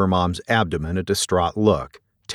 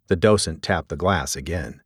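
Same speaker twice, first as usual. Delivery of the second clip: plain reading voice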